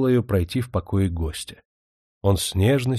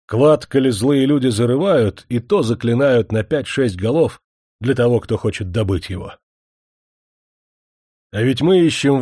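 горячо; 4 semitones higher, 5 dB louder than usual. Acted. Молотобоец горячо поддерживает горщика.

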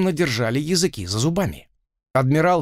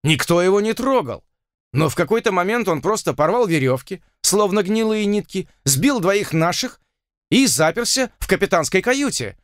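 agitated; 6 semitones higher than usual. A narrator's reading. Frightened crewman defending himself.

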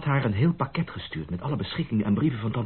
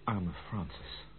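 softer and slow, a sigh of sympathy; soft pity; 3 semitones lower, 10 dB quieter, much slower than usual. Acted. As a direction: mourn her quietly, slowly. Pity on hearing of Francis. (zacht en langzaam medelijdend)

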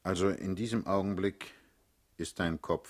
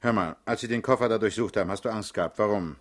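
loud interrogation; 8 dB louder, faster than usual. Acted. Interrogating suspect about suitcase.

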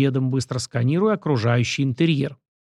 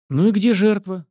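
lightly raised, teasing ironic question; 6 semitones higher, 4 dB louder than usual. A narrator's reading. Grandson needling grandfather about the intended victim, ironic. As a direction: ask it with a touch of irony. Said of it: "иронично поинтересовался Сашка".

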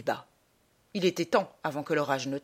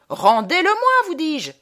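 very high, loud furious demand; enraged shout; 10 semitones higher, 9 dB louder than usual. Acted. Traveller demanding his trunk back, enraged shout. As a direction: yell it, beside yourself with rage.

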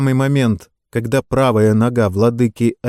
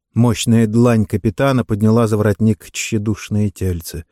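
ordinary delivery

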